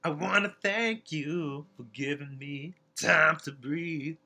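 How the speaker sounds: slow, lightly sung diva imitation; drawn-out pop diva imitation. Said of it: "That's my [name] voice".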